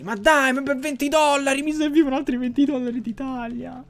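high-pitched exasperated complaint; whining protest. Real, not acted. Gamer short of twenty dollars, high whining protest.

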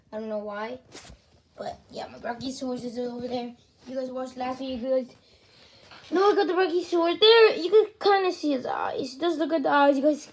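ordinary talk

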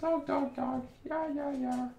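very high, soft squeal to a pet; high pet-talk voice. Real, not acted. Streamer chattering back at the dog, very high squeaky voice.

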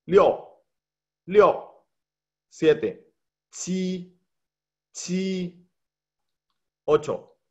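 each Chinese number said clearly with its tone; Mandarin numbers drill. Shown as a demonstration. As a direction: model each number for students to repeat. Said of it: "Entonces va de nuevo".

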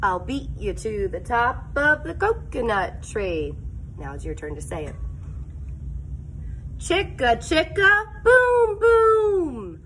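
normal talking voice

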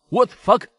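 high, loud shocked exclamation; stunned curse. Acted. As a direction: blurt it out in disbelief. Onlookers shocked by knockout punch. (旁观者惊呆了，高声惊叫)

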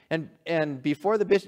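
ordinary talk

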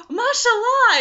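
high, loud, joyful exclamation; retold delight. Sister meeting a new revert, loudly delighted. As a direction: cry it out with delight after a gasp.

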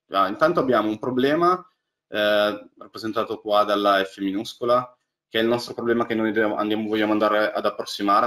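normal talking voice

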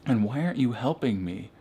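ordinary delivery

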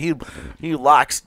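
slow, drawn-out mock Southern accent; Southern twang. Imitating a brother who moved to Georgia, Southern twang. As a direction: imitate a Southern drawl, stretching the words. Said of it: "I can't even do it th-, that well".

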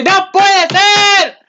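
high, loud, very slow cry; disbelief. Retold or imitated. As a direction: drag it out in stunned disbelief. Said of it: "Yo dije". (grito agudo, fuerte y muy lento de incredulidad)

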